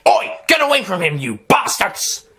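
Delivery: loud angry shout; father yelling. Acted. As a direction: protect the boy, yell at them. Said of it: "his father yelled".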